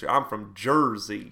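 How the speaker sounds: slow, drawn-out mock Jersey accent. Shown as a demonstration. Teacher playing a proud New Jersey local, light Jersey accent. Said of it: "jump into a few different accents".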